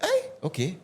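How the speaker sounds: ordinary talk, nothing marked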